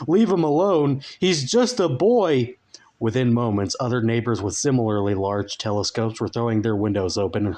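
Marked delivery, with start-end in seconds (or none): 0.1-2.5 louder, much higher desperate neighbour's cry; crying out for mercy. Acted. Obese neighbour shouting from her window for mercy. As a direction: plead at the top of her voice. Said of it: "cried out for mercy".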